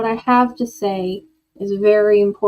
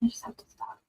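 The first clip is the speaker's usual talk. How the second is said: whisper; 17 dB quieter, much faster than usual. Real, not acted. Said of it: "I gotta stop".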